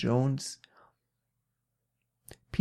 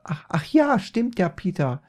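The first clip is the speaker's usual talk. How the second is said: high, loud mother voice; sudden realization; 6 semitones higher, 5 dB louder than usual. Acted. Mother notices her own wig, high exclamation of realization. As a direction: jump up high as it dawns.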